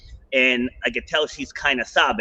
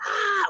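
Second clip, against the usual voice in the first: high mock scream on "ah"; short high yelp; retold scream. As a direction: imitate her scream halfway up the rope. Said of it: "she just screams".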